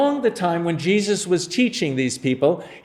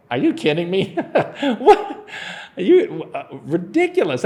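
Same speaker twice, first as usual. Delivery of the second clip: laughing disbelief; 3 semitones higher than usual. Retold or imitated. Jesus voiced reacting to Andrew.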